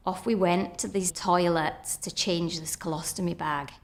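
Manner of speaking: ordinary talk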